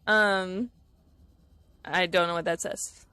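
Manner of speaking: ordinary talk, nothing marked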